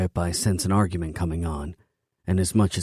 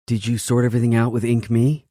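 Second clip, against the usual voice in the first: her voice; 5 semitones higher, 6 dB louder than usual. A narrator's reading. Female character voiced by male narrator.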